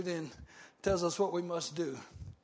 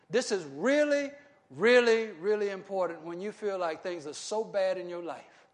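usual speaking voice

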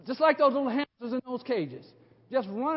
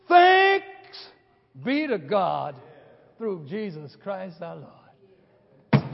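Paul's thanksgiving; 5 semitones lower, 3 dB louder, much slower than usual. Acted. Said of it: "you hear what he said".

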